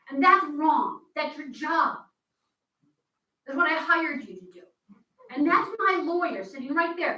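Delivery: getting heated. Real, not acted. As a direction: press it hard, frustrated citizen at the mic. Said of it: "I'm getting heated".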